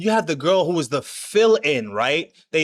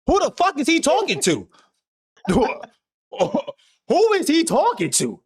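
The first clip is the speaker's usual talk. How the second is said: mock disbelief; 6 semitones higher than usual. Retelling his shock at the twist.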